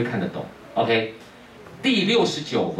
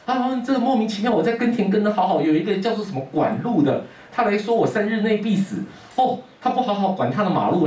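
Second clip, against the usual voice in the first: much higher, exasperated complaint; indignant son; 10 semitones higher than usual. Acted. Son complaining to his father in a much higher, exasperated voice. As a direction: rant it like a fed-up youth.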